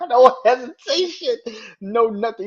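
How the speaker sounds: loud, high voice breaking with laughter; real laughter while marveling; cracking up. Real, not acted. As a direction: laugh hard into the words. Cracks up repeating the punchline.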